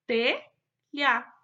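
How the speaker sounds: "Telha" in two loud syllables with a gap; teacher splitting "Telha" in two. Shown as a demonstration. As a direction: sound out "Telha", pausing after the first syllable. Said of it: "por sílabas e monte as palavras".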